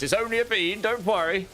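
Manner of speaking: high, sing-song mock comfort; teasing singsong reassurance. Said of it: "hearing things like this".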